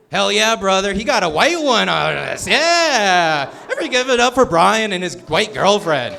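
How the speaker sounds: hype cheer. Real, not acted. Comic cheering on Brian and his girlfriend, loud hype. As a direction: pump the room up. Hyping up crowd member's girlfriend.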